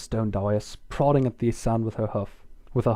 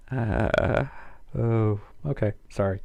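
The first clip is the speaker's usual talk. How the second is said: ordinary delivery, nothing marked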